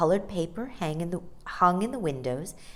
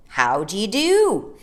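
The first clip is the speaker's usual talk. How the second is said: large voices; 4 semitones higher, 8 dB louder than usual. Acted. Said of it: "in voices as large as themselves".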